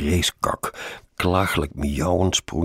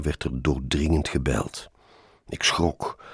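ordinary delivery

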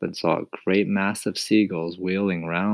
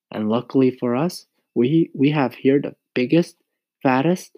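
put-on boy voice; 7 semitones higher, 5 dB louder than usual. A narrator's reading. James teases.

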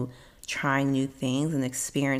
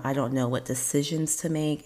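usual speaking voice

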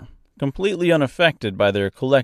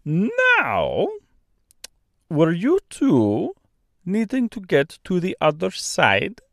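high, slow Austrian-French bird question; Austrian-French accent; 7 semitones higher, slower than usual. A narrator's reading. Stork to Dorothy while carrying scarecrow, Austrian-French accent. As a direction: ask slowly in a mock Austrian-French accent. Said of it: "with a mouthful of scarecrow".

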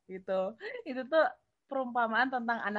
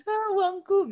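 high, loud whiny wail over money; half-joking fake weep; mock crying; 10 semitones higher, 6 dB louder, much slower than usual. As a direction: ham up a sob over lost cash. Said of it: "Kayak yang menangis".

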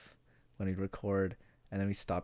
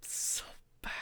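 whispering; 6 dB quieter than usual. Real, not acted. Whispered aside agreeing it was bad.

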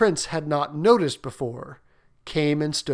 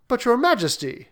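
higher, pleading prince; 8 semitones higher than usual. Acted. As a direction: interrupt the king politely. Prince protests to king.

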